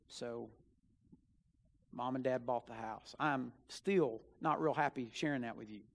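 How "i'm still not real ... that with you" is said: ordinary talk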